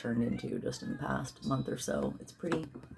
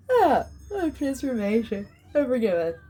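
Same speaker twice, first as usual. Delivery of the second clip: words stretched through a big yawn, higher; yawning while talking; 9 semitones higher, 5 dB louder than usual. Real, not acted. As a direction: chant it mid-yawn. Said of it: "I sound funny when I'm yawning".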